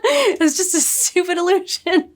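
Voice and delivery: high, scornful cackling sneer; villain laughing at a friend's grief; mocking villain laugh. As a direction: laugh down at the fools. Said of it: "Ishamael's like".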